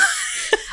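soft high airy laugh; quiet amused laugh. Real, not acted. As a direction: laugh softly.

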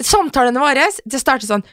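ordinary delivery

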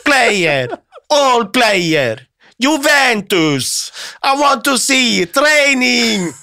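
put-on high, stressed broken English with an Italian accent, falling on each drawn-out phrase. Coach caught spying pleads with guard, thick Italian accent. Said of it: "leverte en stressa Conte den mest gebrokne engelsken".

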